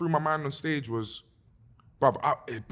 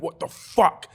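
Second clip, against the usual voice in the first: higher, louder, slowed-down angry demand; retold angry scream; 5 semitones higher, 5 dB louder, slower than usual. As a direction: re-enact yelling at the sound crew. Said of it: "was screaming at the sound team".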